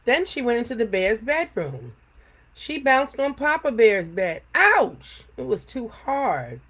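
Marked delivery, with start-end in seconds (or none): 4.5-5.2 ouch. A narrator's reading. Bouncing on Papa Bear's hard bed.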